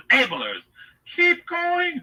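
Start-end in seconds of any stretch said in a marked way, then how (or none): 1.1-2.0 high-pitched gushing cheerleader voice; Jennings lady. Acted. Enabler egging on the accused man, gushing. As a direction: egg him on in a high mock-female voice. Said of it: "the Jennings lady".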